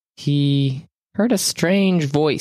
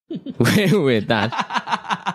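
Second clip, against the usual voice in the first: laughing; 4 semitones higher, much slower than usual. Real, not acted. GM laughing mid-recap.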